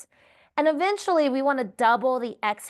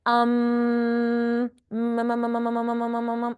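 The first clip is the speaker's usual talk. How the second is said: humming. Shown as a demonstration. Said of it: "what I call the M and M's".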